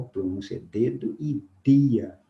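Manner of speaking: ordinary delivery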